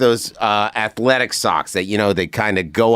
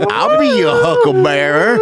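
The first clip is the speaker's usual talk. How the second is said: hammed-up squeaky gunslinger line, much higher and louder; Val Kilmer impression; 5 dB louder than usual. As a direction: toss it off like a dying Old West gambler. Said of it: "like Val Kilmer in Tombstone".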